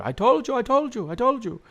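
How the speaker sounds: high-pitched repeated crowing; imitating an excited old man proven right; vindicated old pop. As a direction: gloat in a high grandpa voice.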